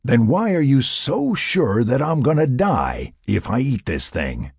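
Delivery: frustrated, drawn-out challenge; exasperated challenge. Acted. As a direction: press her for a real answer. Mac presses Sarah in argument.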